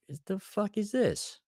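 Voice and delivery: high, slow, drawn-out disbelief; stunned disbelief. Retold or imitated. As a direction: stare at the crowd and drag the question out. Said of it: "and I was like".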